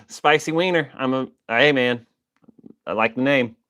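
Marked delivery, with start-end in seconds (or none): none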